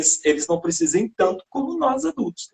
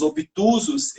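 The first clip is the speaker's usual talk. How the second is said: ordinary talk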